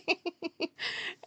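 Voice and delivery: mimicked high laugh of an older lady; laugh imitation. Vlogger imitating the older lady's laugh, a high giggle. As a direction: laugh like the lady behind you. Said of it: "She just starts laughing".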